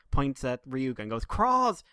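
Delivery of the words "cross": high, loud excited yell; retold scream. Co-host acting out a Rider's crossover moment, retold scream. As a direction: shout out the one word, pitched way up.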